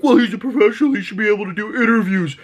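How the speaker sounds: high, fast mocking voice; put-on high-pitched objection; mock complaining fan.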